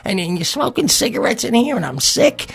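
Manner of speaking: high-pitched stammering tirade; sputtering, squeaky mock rant; imitated Rod. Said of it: "He said it like that".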